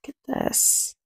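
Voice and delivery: breathy whispered aside. Real, not acted. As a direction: hush it like sharing a secret. Whispered aside to child listener.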